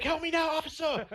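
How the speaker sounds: squealed, frantic plea for help; high-pitched mock yelling. Retold hostage calling the officer, high mock yell. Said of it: "I'm yelling at my mic".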